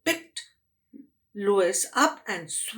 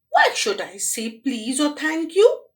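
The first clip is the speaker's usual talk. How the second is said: 1 defiant grumble; 5 semitones higher, 4 dB louder than usual. Acted. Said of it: "said Lois to herself".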